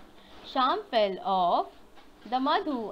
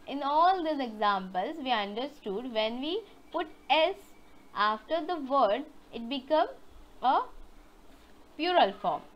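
ordinary talk

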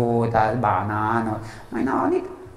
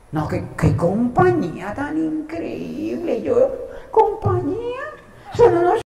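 mock gushing praise; 13 semitones higher, slower than usual. Retold client praise, high voice.

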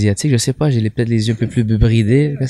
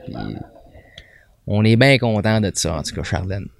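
Quebec accent; 2 semitones lower than usual. Shown as a demonstration. Comic telling the host about stage debuts, Quebec accent. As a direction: chat in a thick Quebec accent. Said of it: "québécois".

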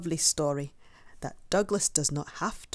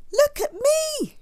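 high, loud, drawn-out call; excited shout; 7 dB louder, slower than usual. A narrator's reading. Young bear showing off to his friends, excited shout. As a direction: shout it proudly, stretched out high. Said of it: "shouted Douglas".

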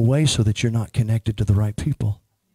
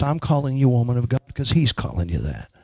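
usual speaking voice

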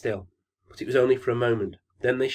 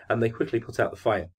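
plain reading voice

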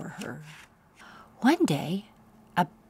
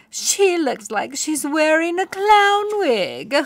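much higher and louder, shrill jeer; mock her mane mercilessly; jeering mockery; 10 semitones higher, 8 dB louder than usual. A narrator's reading. Jealous pony mocking Poniella's mane, loud jeering. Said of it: "snorted Bunbun jealously".

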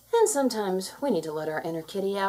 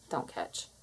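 much lower and softer, a whisper; whispered don't; 9 semitones lower, 13 dB quieter than usual. Shown as a demonstration. Narrator warning the kitten, a soft whisper. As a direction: hush it like a warning.

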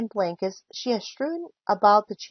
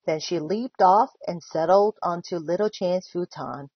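ordinary delivery

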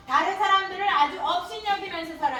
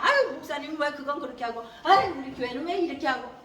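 lower, laughing mock grumble; grumbling sigh; 4 semitones lower than usual. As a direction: sigh and gripe about the church. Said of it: "항상 툴툴거려".

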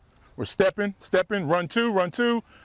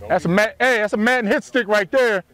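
calling out; 3 semitones higher, 6 dB louder than usual. Real, not acted. Coach calling out on field.

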